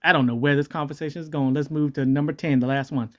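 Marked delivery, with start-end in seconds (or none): none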